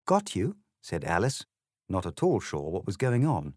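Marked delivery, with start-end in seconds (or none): 0.1-0.6 put-on a higher, confused girl's echo. Alice, confused by the egg, lightly voiced girl. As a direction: echo it back, baffled.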